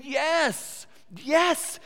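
high, eager yes yes; the nodding crowd agrees; eager agreement. Retold or imitated. Listeners eagerly agreeing with the parable. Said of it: "They're saying".

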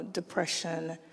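normal talking voice

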